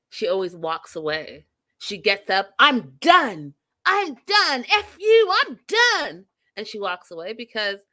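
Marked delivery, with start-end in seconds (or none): 2.6-6.3 louder, higher storm-off yell; hammed-up angry exit; mock furious outburst. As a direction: imitate her flouncing out, punching each "done". Acted storming off, shouted.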